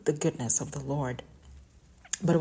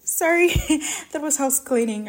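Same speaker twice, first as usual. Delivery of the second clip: higher, louder flustered apology with a laugh; embarrassed laugh; 10 semitones higher, 7 dB louder than usual. Real, not acted. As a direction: laugh off the interruption. Interrupted by cleaning noise, apologizing.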